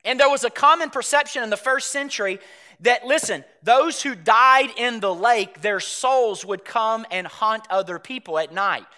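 ordinary delivery

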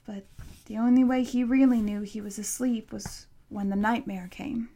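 ordinary delivery, nothing marked

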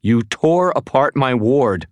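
high, loud, indignant woman's voice; slow, emphatic outraged cry; angry accusation. Acted. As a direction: hurl the accusation back. Woman accuses intruder in argument.

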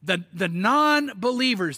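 ordinary talk

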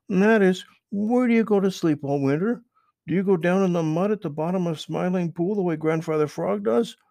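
high, loud, eager rabbit question; curious Peter Rabbit. Acted. Peter asks Mister Toad, high and eager. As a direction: pitch up high and push it out eagerly.

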